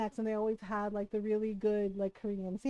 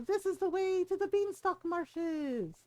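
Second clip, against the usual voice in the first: slow, higher storybook read-aloud of game text; slow, higher read-aloud of a game line; game dialogue read; 6 semitones higher than usual. Acted. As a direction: announce it like the game's narrator.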